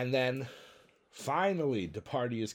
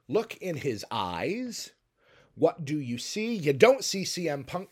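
wrestling promo hype; 5 semitones higher, 5 dB louder than usual. Pretend. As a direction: hype it like a ring announcer. Hyped wrestling promo build-up.

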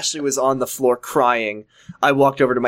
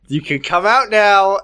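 higher, drawn-out call; attempted Ashley voice; 7 semitones higher, 4 dB louder than usual. A narrator's reading. Sister calling someone out of her bedroom, higher put-on voice. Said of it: "unable to do Ashley voice".

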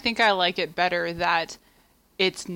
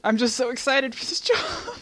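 higher, hammed-up crying voice; mock tearful; 5 semitones higher than usual. Acting out a crying job candidate to the interviewer, mock tearful. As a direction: pretend to blubber through your excitement. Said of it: "Why are you crying?".

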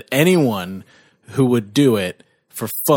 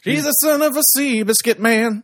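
put-on old sailor voice; 11 semitones higher than usual. Playful salty sailor voice.

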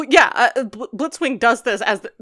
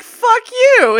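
much higher, louder furious bark; angry yell; 12 semitones higher, 7 dB louder than usual. Retold or imitated. Lugnut yelling at Blitzwing as he slams him down. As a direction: yell it as you slam him down. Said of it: "And Lugnut's like".

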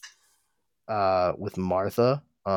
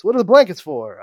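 eager Clark; 9 semitones higher, 8 dB louder, faster than usual. Retold or imitated. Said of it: "Clark asks".

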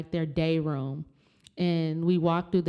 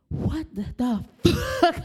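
outraged; 11 semitones higher, 4 dB louder than usual. Retold or imitated. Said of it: "pissed to the thirteenth power".

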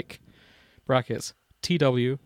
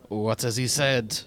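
put-on French accent; 2 semitones lower, slower than usual. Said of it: "Sai's French".

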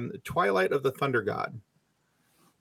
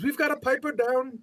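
high, wailing mock call of a man down; mock crying call; 12 semitones higher than usual. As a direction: wail it like a soldier in distress, half laughing. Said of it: "that's all I was hearing".

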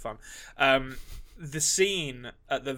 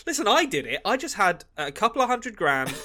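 a hint of a smug tycoon; lightly voiced smug elite; smug rich guy; 7 semitones higher, 3 dB louder than usual. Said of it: "You're like".

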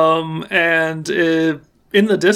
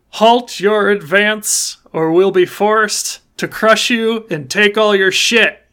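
slow, loud, raised voice of command; commanding announcement; 5 semitones higher, 5 dB louder, slower than usual. Acted. Guards commanding intruders to halt, loud and slow. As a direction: boom it out as a drawn-out order. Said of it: "just commanding you to".

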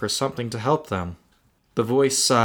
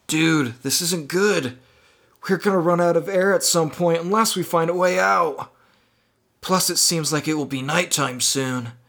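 worried Jason in the cave; 6 semitones higher than usual. Acted. Jason to Marty, stuck in the cave, worried and fed up. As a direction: fret about being trapped, growing annoyed.

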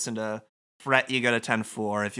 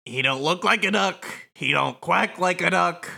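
sneering villain; 6 semitones higher, 3 dB louder than usual. Acted. Voicing villain line.

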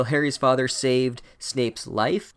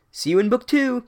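chirpy goodbye; 11 semitones higher, 4 dB louder than usual. Retold or imitated. Dumbledore leaves cheerily.

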